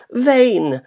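high mournful maidservant; serving woman's voice. Acted. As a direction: play the weeping serving woman. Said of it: "she replied".

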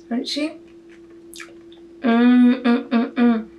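talking with mouth full; mumbling while eating; chewing. Real, not acted. Seen 2: Spoonful at -3.0, then closed-mouth chewing through about 2.3 s.

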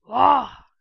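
very loud burst of a cry; sudden exclamation. Real, not acted. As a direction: blurt it out at full volume. Sudden outburst at the screen. (aniden yüksek sesle bağırma)

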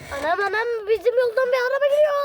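high, loud, fast alarm; excited alarm. Pretend. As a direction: blurt it out in a rush of panic. Car coming, pretend crash.

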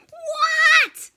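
high loud what; startled shout. Acted. Startled penguin shouting at the stranger, high and loud. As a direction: jump and shout it.

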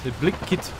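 "Blick" said with a clipped South African vowel. Acted. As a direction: joke South African accent on Blick Kit. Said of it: "stereotype South African accent".

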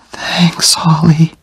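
faint unvoiced whisper; last breath whisper. A narrator's reading. Dying Jenny's last thanks to Oliver, a faint whisper. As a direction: barely get the words out.

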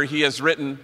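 ordinary delivery

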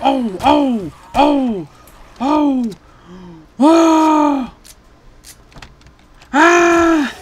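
string of high, loud whoops; excited cries. Real, not acted. Cries out during game fight.

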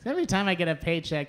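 ordinary delivery

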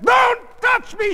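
slow, very high, loud shriek; scream. A narrator's reading. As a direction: scream to drive him off. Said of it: "she screamed".

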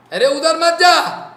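loud, high re-enacted warning; acted shout to stop someone; exasperated warning. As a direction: call it out sharply, raising the pitch. Re-enacts his exasperated repeated warning.

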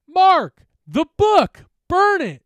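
very high, very loud cries; urgent exclamation. Acted. Jen urging her friend to burn the book, screaming. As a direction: scream the instruction in short bursts. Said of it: "she exclaimed".

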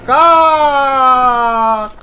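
sharp loud high yelp; high shriek. Real, not acted. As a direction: shriek it suddenly. Shrieking in game.

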